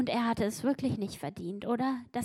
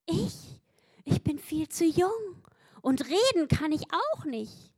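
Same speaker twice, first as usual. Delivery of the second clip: higher, hesitant excuse; a hint of a nervous young prophet; lightly voiced Jeremiah; 6 semitones higher, 4 dB louder than usual. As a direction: Jeremiah protesting he is too young. Jeremiah objecting to God.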